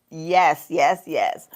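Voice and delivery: ordinary delivery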